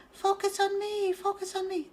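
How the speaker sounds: high, squeaky-small pretend turtle; little turtle voice. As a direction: speak up as a tiny turtle begging the camera. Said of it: "And the little turtle says".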